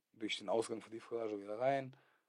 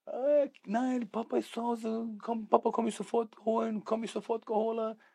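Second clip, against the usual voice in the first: viel höher und lauter, ein drängendes Kind; Kinderstimme; 12 semitones higher, 6 dB louder than usual. Retold or imitated. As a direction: spiel den kleinen Sohn, der sofort zu Papa will. Kleiner Sohn am Telefon. (Sohn am Telefon, hohe drängende Kinderstimme)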